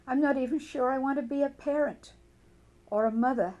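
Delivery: an outburst of doubt about being a mother. Pretend. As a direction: let the hard truth burst out. Tapping script, voicing doubt about motherhood.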